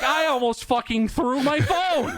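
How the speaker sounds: high, drawn-out, half-laughing outburst; exasperated. Real, not acted. Reliving near phone-throwing exasperation.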